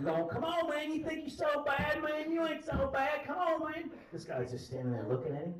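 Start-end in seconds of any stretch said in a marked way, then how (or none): 0.3-3.9 high, hollered taunting; pitched-up mock trash talk; jaw-jacking. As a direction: imitate a drunk goading someone to fight. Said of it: "this guy is just jaw-jacking".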